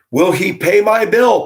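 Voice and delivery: high, loud mocking read of the caption; mocking high whine. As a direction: whine it up high like a needy plea. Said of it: "Part two".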